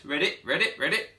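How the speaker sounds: high, bouncy frog croak. Acted. Frog hopping into the library, croaky high calling.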